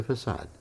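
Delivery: FACADE as "fuh-SAHD"; correct pronunciation. Retold or imitated. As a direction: say FACADE with a soft s and an ah. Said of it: "it's pronounced".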